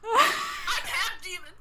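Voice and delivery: high, loud, slow hammed-up sobbing shout; mock crying wail. Retelling the hero's line to friends, hammed-up sobbing shout. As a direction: wail it like a melodramatic half demon.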